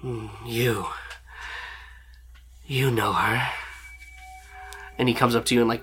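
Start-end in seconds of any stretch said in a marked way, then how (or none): 0.0-3.5 quiet, whispered villain murmur; soft menace. Acted. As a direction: savour the discovery. Captain reads player's mind.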